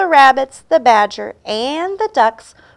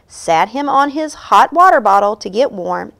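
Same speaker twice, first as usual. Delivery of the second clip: ordinary delivery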